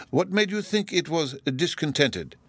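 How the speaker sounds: high, slow dignitary's voice; unhurried high-voiced official; Lord Chancellor. A narrator's reading. Lord Chancellor questions Bruno.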